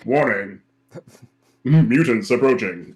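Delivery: loud robotic warning, lips barely moving; ventriloquist Sentinel voice. Pretend. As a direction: boom it out like a robot sentinel through still lips. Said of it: "ventriloquist Sentinel impression".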